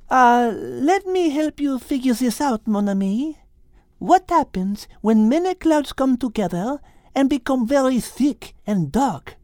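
put-on high French-accented teacher voice; french chef. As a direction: teach it like a cheerful French cook. Liz's lines in story.

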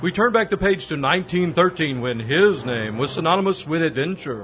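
put-on old radio announcer voice. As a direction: announce it like a serial. Radio show announcer intro.

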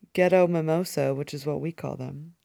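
normal talking voice

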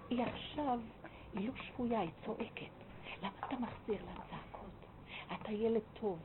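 hushed, gentle reasoning; soft speech. Retold or imitated. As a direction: talk him down softly, as to a good child. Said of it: "נתן לי דיבור רך לאיש הזה". (דיבור רך ושקט, בפיוס)

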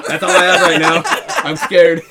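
loud, laughing fake panic; laughing mock fear. Telling friends what he said to the teacher, loud and laughing. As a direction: blurt it out through laughter, pretending to be scared. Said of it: "I was like".

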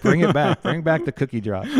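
rally the church to bring back the cookies; playful rallying call. Real, not acted. Jokingly calls for cookie drops.

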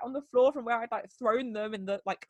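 normal talking voice

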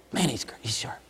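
whispered admiration; awed whisper. Pretend. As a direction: whisper it, impressed.

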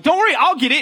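put-on high, loud eager boy; high excited kid voice. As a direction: play young Smalls offering to fetch it. Smalls voiced in retold movie.

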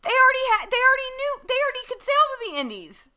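exasperated. Real, not acted. Exasperated rant at story logic.

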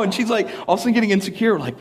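usual speaking voice

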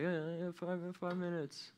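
put-on drowsy kid stalling in bed; sleepy mumbled reply. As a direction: beg softly for five minutes, half asleep. Sleepy child answers parent.

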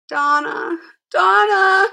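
high despairing screams of the name; screaming in despair. A narrator's reading. Mother in the police car screaming for Donna in despair. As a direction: cry out to her daughter as she is taken. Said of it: "screaming in despair".

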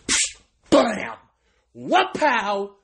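loud comic impact sounds; explosive cartoon-style sound effects; imitated attack noises. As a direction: punch out each noise high and hard.

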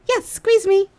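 high, loud "Yes, squeeze me"; insistent plea. Retold or imitated. Insisting to the man she wants a squeeze, high playful plea.

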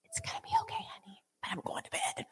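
unvoiced soothing whisper; hushed whisper. Owner whispering goodnight to her dying dog. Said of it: "And I was like".

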